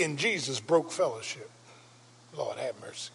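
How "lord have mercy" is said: ordinary delivery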